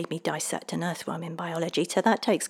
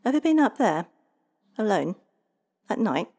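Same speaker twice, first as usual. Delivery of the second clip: pointed questions, higher and louder; ominous challenge; 5 semitones higher, 6 dB louder than usual. Acted. The leprechaun warning Kyle about the mountains, ominous challenge. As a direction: dare him to go up there.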